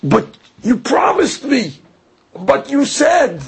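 high, pleading mock cry of betrayal; Hava's protest. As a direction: play Eve crying foul at the snake. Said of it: "and said".

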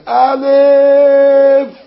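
loud, high echoing cry; crowd roar. A narrator's reading. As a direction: shout it back like a whole congregation. Congregation echoes the rabbi.

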